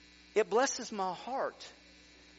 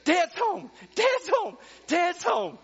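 much higher, loud gleeful shouting; dog's excited shout; 13 semitones higher, 11 dB louder, slower than usual. Pretend. Pastor voicing his dog greeting him home, gleeful shout. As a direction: yell it like a thrilled dog at the door. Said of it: "he just gets excited".

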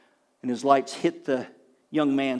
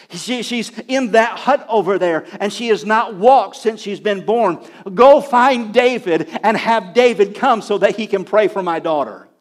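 raised, emphatic preacher's retelling of a plea; urgent appeal; 5 semitones higher, 7 dB louder, slower than usual. Retold or imitated. Chief sending the woman to find David, urgent. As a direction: press each phrase with urgency. Retold chief demanding help for daughter.

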